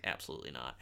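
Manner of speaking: low quiet aside under the breath; dropped, quiet rebuttal; muttered aside. Real, not acted. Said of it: "muttered aside during review".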